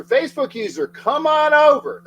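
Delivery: calling out. Real, not acted. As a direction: call them over like a barker. Inviting viewers to switch platform.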